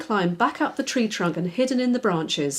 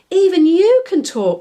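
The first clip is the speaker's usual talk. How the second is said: high, loud astonished cry; can't believe it; girl astonished a spider speaks; 10 semitones higher, 8 dB louder than usual. Acted. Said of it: "cried Daisy in astonishment".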